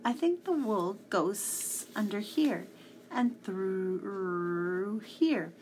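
slow cat voice with long hissed S's; purring cat, drawing words out; Splinter Cat fussing. Acted. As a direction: roll and stretch the R's like a purr.

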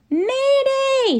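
very high, loud squawked alarm; shrill bird shriek. A narrator's reading. The whiffle bird raises the alarm, shrill shriek. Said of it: "shrieked in an incredibly shrill voice".

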